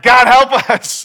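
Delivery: loud, high, drawn-out cry for help; re-enacted desperate shout; crying out to God. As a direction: yell it out in mock panic. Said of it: "we just cried that out".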